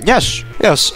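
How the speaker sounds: ordinary delivery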